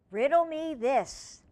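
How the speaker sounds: loud, slow riddler voice; hammy villain challenge; dramatic villain voice. Acted. Dramatic aside.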